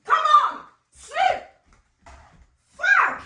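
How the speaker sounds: very high, loud outburst; enraged shouting. Acted. Wife losing her temper at husband, enraged shouting. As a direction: scream insults and commands in bursts.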